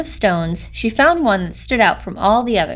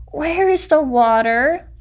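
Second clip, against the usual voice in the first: slow, a touch higher, lightly voiced little creature; small raspy voice; 5 semitones higher, slower than usual. A narrator's reading. Newborn chicken asking the frogs for water, slow and small. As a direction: ask it slowly in a small creature voice. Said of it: "said in a small, raspy voice".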